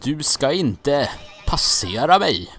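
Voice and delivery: loud, slow booming wizard command; Gandalf quote. Retold or imitated. Gamer quoting the wizard to an enemy, loud slow boom. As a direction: bellow it like a wizard blocking the bridge. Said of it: "som han säger i, Hobbit".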